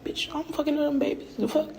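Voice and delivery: high-pitched, exasperated comeback; indignant retort. Retold or imitated. Her answering critics about the child, indignant and high. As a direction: fire back in mock outrage, pitched well up.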